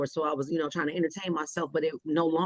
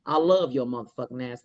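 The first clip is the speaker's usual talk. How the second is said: blunt and abrasive; 6 semitones lower than usual. Shown as a demonstration. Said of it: "it was the same shit".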